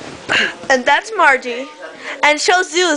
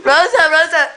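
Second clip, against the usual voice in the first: normal talking voice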